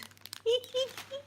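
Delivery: high-pitched little giggle. Real, not acted. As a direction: titter in delight. Amused while looking through food.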